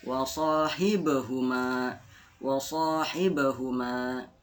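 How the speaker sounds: slow recitation of "Wa sahibahuma", short "e" after the b; bouncing b. Shown as a demonstration. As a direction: bounce the b in "Wa sahibahuma", long final "ma". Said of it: "ada huruf e-nya".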